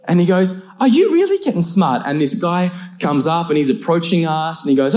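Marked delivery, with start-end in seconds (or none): 0.8-2.0 high-pitched incredulous challenge; mock challenge. Stranger presses the mouthy cousin, high mock challenge.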